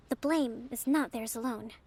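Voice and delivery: usual speaking voice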